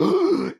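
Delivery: high, quick intake of mock shock; imitated gasp. Telling how the villain reveal landed, mock gasp. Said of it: "make me go like".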